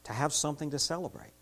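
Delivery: soft, reverent closing prayer; hushed reverent prayer. Acted. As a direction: pray quietly to close. Closing prayer before sermon.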